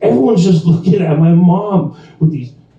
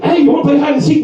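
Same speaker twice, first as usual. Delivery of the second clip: high, quick mock invite; playful invitation; 13 semitones higher, much faster than usual. Wife invites him to play, high quick mock tease. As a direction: toss out the offer fast and bright.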